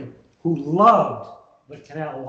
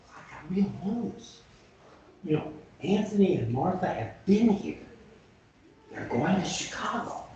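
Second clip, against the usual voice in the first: hushed report; 6 dB quieter than usual. Retold or imitated. Henry secretly reports slaves' trail.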